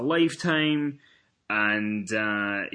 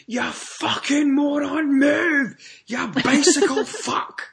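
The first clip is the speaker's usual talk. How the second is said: much higher and louder, slow hammed-up yelling; imitating a furious postman; 5 dB louder, much slower than usual. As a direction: scream abuse at the cyclist from the van. Postman screams abuse through window.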